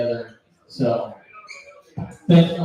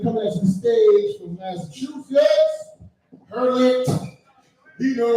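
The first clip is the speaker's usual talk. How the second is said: higher, building introduction of an act; hyped stage announcer; 8 semitones higher than usual. Real, not acted. Host bringing up next comic, hyped announcer call.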